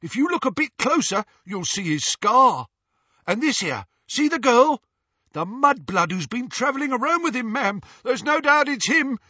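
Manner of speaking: high, loud, eager thug voice; eager Snatcher voice. Acted. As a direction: wheedle eagerly to the lady. Scabia keeps pressing Narcissa.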